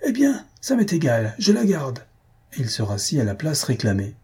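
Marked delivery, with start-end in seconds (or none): 0.0-2.0 a much higher, defiant declaration; defiant threat. Acted. Maroquin defying the man who wants his seat, angry. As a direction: plant yourself and refuse to budge. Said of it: "d'une voix terrible".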